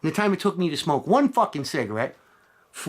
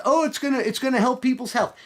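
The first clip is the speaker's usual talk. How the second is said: mocking sarcasm; 8 semitones higher than usual. Mocking what government claims.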